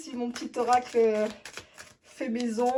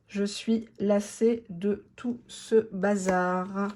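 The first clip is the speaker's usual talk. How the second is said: "Je suis lassée de tout ce bazar" stretched out very slowly; savouring the card's message slowly; slow emphatic reread; 2 semitones lower, much slower than usual. Shown as a demonstration. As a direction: drag out each word to let it sink in.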